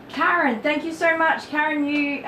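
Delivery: high, bright burst of thanks to a viewer; delighted shout-out to a generous viewer; excited thanks. Real, not acted. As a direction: lift the voice up high and gush the thanks. Thanking a gifter on stream.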